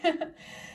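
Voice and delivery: airy unvoiced chuckle; breathy laugh. Real, not acted. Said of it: "So I'm laughing because".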